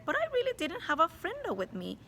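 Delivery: put-on cheeky boy. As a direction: play the clever rascal denying it. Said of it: "looked her in the eye and said".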